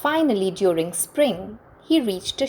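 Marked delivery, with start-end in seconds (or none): none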